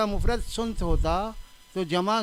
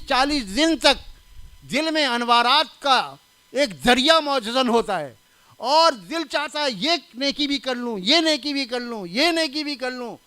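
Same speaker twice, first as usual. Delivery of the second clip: louder, impassioned sermon voice; fervent preaching; 4 semitones higher, 5 dB louder than usual. Acted. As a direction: preach it with rising fervour, near tears.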